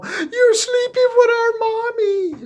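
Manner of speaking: put-on high childish voice on the phone; mock child accusing him over the phone; kid voice. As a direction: squeak it like a little kid. Kids calling on phone.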